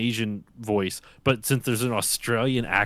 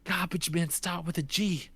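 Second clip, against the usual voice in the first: higher, softer mock exasperation; exasperated dad; 7 semitones higher, 4 dB quieter than usual. Dad alone in the car after son left, exasperated. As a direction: fume to yourself in imitated despair. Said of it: "that super exasperated".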